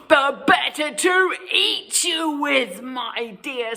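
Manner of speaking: loud snarling wolf; wolf snarling at Little Red. Acted. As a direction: snarl before the pounce. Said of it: "snarled the wolf".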